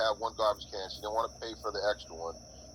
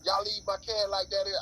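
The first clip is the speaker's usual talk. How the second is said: imitating angry mom; 9 semitones higher than usual. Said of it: "She's like".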